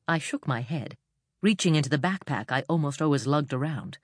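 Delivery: usual speaking voice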